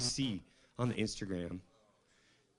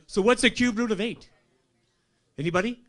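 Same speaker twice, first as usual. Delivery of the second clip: louder and higher, daring them; challenging the crowd; 8 semitones higher, 6 dB louder than usual. Real, not acted. Quizzing the comedy crowd on his IQ, loud and cocky. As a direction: put the audience on the spot.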